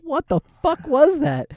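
shouted in retold panic; alarmed yell. After the flash burns his eyebrows, a startled yell. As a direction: yell it out, face just caught fire.

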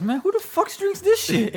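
very high, loud imitation of a friend; mock outburst. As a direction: blurt it way up high, mocking his disbelief.

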